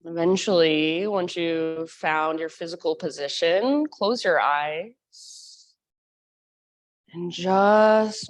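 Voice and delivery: calm meditation guide voice; guided meditation. Acted.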